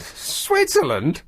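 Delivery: high, slow, drawn-out disbelief; incredulous. Real, not acted. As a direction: stretch it out in disbelief. Disbelief at happy list.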